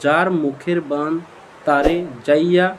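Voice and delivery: each word spaced out slowly; slow recitation. Shown as a demonstration. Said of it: "আবার একবার শুনুন". (ধীরে ধীরে থেমে থেমে মন্ত্র পড়া)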